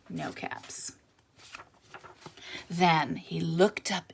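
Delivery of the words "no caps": soft unvoiced letdown; quiet disappointed whisper; hushed dismay. A narrator's reading. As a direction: let it drop to a whisper. Last search finds nothing.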